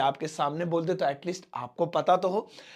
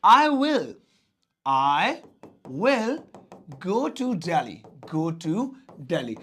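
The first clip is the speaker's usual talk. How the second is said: each word spaced out slowly for the board; teacher dictating word by word to students, slow and spaced; slow dictation; much slower than usual. Shown as a demonstration. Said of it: "तो मैं छुट्टियों में दिल्ली जाऊंगा".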